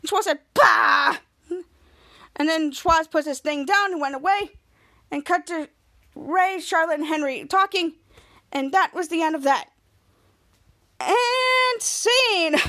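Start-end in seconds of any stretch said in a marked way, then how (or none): 0.5-1.2 loud, drawn-out dismissive "bah"; mock grumpy bah. Schwoz told to put it away, mock grumpy bah. 11.0-12.6 high, drawn-out singsong announcement; theatrical sign-off. Pretend. Ending the episode recap, theatrical sign-off.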